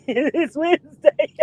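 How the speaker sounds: high, giggling correction; laughing. Real, not acted. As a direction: say it through laughter. Laughing at viewer's mistaken day.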